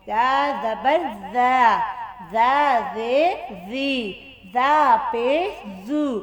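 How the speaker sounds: slow drill, "जा" as a lisped dha; tongue-between-teeth sound. Shown as a demonstration. Teacher demonstrates tongue under the teeth, slow "जा जी जू". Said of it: "ज़ुबान को दांतों के नीचे लो".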